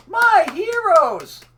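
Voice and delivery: high, slow, sarcastic hammed-up cheer; mock heroic praise. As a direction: sneer it like a fake admirer.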